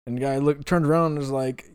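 usual speaking voice

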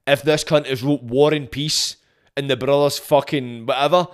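ordinary talk

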